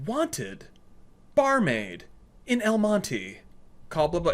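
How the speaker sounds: high, announcer-ish mock of a recorded ad; a lightly voiced old answering-machine ad; recorded message. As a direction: read it like a cheesy phone message. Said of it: "it was an outdated message".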